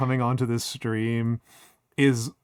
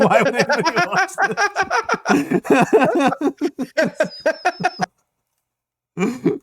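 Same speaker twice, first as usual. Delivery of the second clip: much higher, louder, mock-shocked; high exaggerated disbelief; 13 semitones higher, 5 dB louder than usual. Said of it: "it cuts back to them saying".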